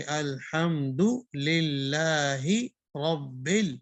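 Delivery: الحمد للہ recited slowly and clearly; slow, proper tajweed reading; correct recitation. Shown as a demonstration. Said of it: "کو کیا پڑھنا چاہیے". (الحمد للہ صحیح تلاوت کے ساتھ)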